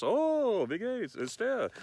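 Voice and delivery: high, lightly voiced reply; imitating a German. A German answering, lightly voiced. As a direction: play the German who shrugs it off.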